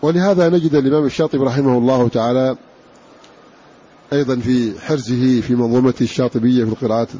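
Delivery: normal talking voice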